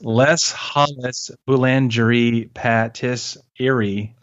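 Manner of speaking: slow, butchered French pronunciation; mock French. Shown as a demonstration. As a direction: say the French name slowly, with mock expertise. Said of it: "I'm gonna say it in the French".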